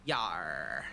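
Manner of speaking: low pirate "Yar"; pirate growl. Pretend. Pirate talk after a pirate flag.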